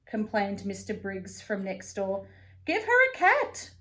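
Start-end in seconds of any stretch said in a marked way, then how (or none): 2.7-3.7 much higher, louder fed-up neighbour; complaining neighbour. Acted. Missus Figgs complains over the wall, high and loud. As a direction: squawk it as a grumpy old neighbour. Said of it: "complained Missus Figgs".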